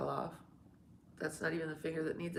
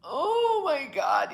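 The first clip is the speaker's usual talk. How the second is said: much higher, loud, slow outcry; shout; 10 semitones higher, 9 dB louder, much slower than usual. Real, not acted. Streamer shocked at something on screen. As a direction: cry it out in shock.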